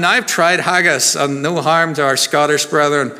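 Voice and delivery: ordinary delivery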